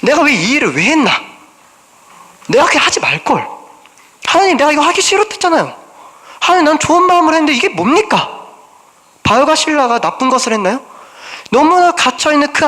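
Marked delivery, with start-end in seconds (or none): 0.0-8.3 higher, whiny imitated complaint to God; a lightly voiced aggrieved prayer, pitch rising; mock frustrated prayer. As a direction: act out a hurt believer's grumbling. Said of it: "그럴 때 나의 심정은 어떨까요?".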